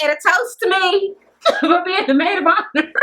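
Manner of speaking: high-pitched, laughing imitation; mock self-toast. Imitating Juicy toasting herself as maid of honor, laughing mockery. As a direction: crow it and break into laughter.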